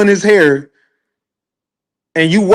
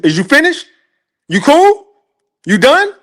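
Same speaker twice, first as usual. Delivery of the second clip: mocking taunt; 10 semitones higher than usual.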